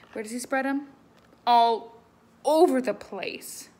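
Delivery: lively, loud read-aloud voice; animated picture-book reading; story reading to children. Acted. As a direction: read it big for the kids.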